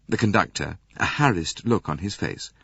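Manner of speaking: plain reading voice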